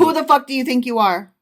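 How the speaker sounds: fast, loud indignant deity; angry God. Pretend. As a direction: bellow back at the questioner, fast and loud. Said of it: "I'm God".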